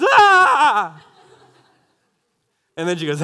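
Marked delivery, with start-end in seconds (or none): 0.0-1.0 put-on high, loud little girl's shriek with a laugh; mock-frightened child's squeal; daughter's startled scream. Daughter startled by dad's boo, high shriek. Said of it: "and she goes".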